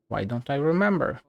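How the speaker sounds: ordinary delivery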